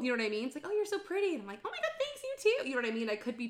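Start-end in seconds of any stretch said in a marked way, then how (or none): 0.7-1.3 high, sing-out imitation of flattery; gushing compliment. Voicing women's compliments. 1.6-2.7 put-on very high-pitched girly-friend gush; gushing thanks. Oblivious gushing reply, acted.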